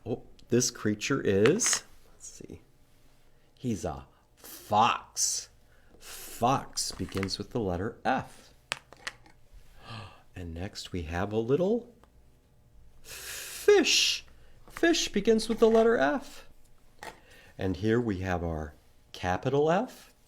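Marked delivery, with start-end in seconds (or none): 2.1-2.6 soft whisper; whispered aside. Real, not acted. Hushed peek at the next picture. 3.6-5.5 slow, sly reveal. Shown as a demonstration. 13.0-16.5 very high squeaky reveal; tiny fish voice. Shown as a demonstration.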